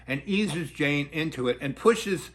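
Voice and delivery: ordinary talk, nothing marked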